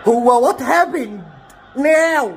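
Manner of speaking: very slow, drawn-out disbelief; bewildered outcry. Real, not acted. Fan asking what on earth is happening, bewildered outcry.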